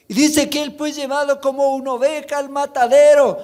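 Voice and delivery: high, loud pulpit preaching that pushes each phrase; full-force preaching. Acted. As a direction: preach it with fire to the congregation.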